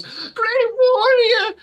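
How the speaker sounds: high, slow gushing elf woman; breathless high admirer. Acted. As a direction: swoon over the great warrior. GM voices elf woman greeting.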